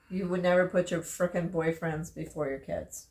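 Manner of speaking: ordinary talk, nothing marked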